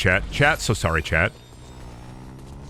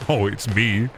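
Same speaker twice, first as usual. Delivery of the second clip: usual speaking voice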